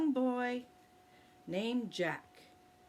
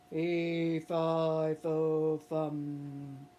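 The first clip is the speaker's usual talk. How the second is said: giant's voice; 4 semitones lower, much slower than usual. Acted. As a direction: lower giant chant, fading at the end. Giant chant repeated.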